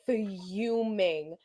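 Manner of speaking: very slow, drawn-out words; emphatic fuming. Retold or imitated. Storyteller tells friend how mad the texts made her, drawn-out. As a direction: stretch each word out for dramatic emphasis. Stretches words to stress past anger.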